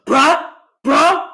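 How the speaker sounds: a hint of a dude saying bruh; lightly voiced dude, calling out bruh; mock bro voice. Mocking dudes who say bro.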